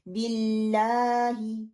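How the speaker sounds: बिल्लाही with the "laa" held just two counts. Shown as a demonstration. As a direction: recite बिल्लाही slowly, holding the "aa" two beats. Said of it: "दो दफ़ा ही लंबा करना".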